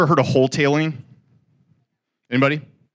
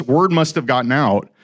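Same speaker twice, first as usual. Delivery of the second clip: ordinary talk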